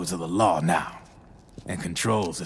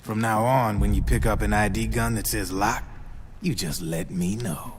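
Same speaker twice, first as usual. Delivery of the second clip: usual speaking voice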